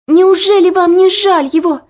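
put-on high, loud, breathless girl's plea; breathless pleading. A narrator's reading. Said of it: "задыхаясь, проговорила Оля". (задыхаясь, высоко и громко)

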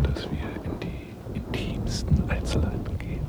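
hushed, discreet confiding; discreet whisper. Acted. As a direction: drop to a secretive whisper. Discreetly skipping intimate details.

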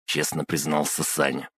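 ordinary delivery

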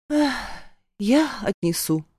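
соглашаясь со вздохом; вздохни и нехотя согласись; с лёгким вздохом. A narrator's reading. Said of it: "вздохнув". (со вздохом)

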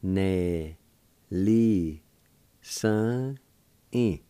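held syllables, a pause after each; syllable-by-syllable demo. Shown as a demonstration. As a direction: sound it out slowly for learners. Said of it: "it's pronounced like this".

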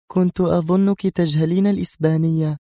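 high-pitched, teasing needle; sly. A narrator's reading. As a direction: needle her with a wicked smile. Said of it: "ابتسامة خبيثة وهي تقول". (بخبث)

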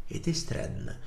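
soft puzzled mutter. Acted. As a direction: mutter it under your breath. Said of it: "пробормотал он".

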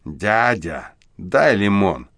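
a hint of a brazen hoarse street kid; the boy drops the act and turns brazen; cheeky husky voice. A narrator's reading. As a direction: demand it, insolent and rough. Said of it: "наглым хрипловатым голосом".

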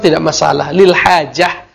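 ordinary talk, nothing marked

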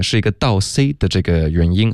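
normal talking voice

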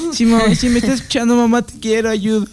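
joking cry for rescue; mock plea for help. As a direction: call out to mom as a pretend hostage.

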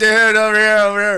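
mock car calling for help. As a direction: call out like the car wants attention.

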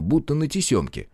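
ordinary delivery

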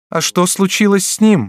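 high frightened outcry; frightened cry. A narrator's reading. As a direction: cry out in sudden fear. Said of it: "вскричала она".

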